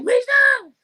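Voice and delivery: high, loud warning shout; yell. Acted. Friend imitating a scary-movie viewer, yelling a warning. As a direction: yell the name in mock panic. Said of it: "He ready to yell like".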